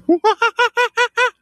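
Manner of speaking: high whooping kookaburra-style call; monkey call. Shown as a demonstration. Guide calls to the kookaburras, high whooping cackle. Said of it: "now I just sound like a monkey".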